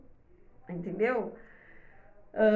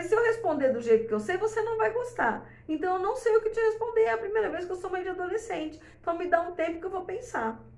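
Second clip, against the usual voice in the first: desabafo sincero; 8 semitones higher, faster than usual. Retold or imitated.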